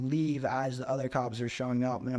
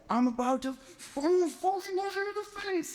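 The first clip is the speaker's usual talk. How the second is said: climbing much higher, a mock-furious outburst; enraged threat; 14 semitones higher than usual. Riled-up man threatening to punch the host, imitated rage. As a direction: spit it out like a man about to swing. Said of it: "he's like".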